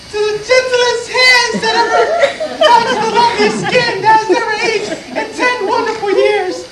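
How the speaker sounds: high, singsong falsetto as the wife; wife's toast. Acted. Wife answers her husband's toast, high falsetto imitation. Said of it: "she, she toasts".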